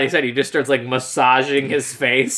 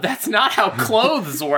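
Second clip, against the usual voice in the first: slow, drawn-out disbelief, higher; incredulous remark; 6 semitones higher, much slower than usual. Retold or imitated. Watching the movie, saying it out loud in slow disbelief. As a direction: spell it out slowly, unable to believe it. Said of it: "I literally said out loud".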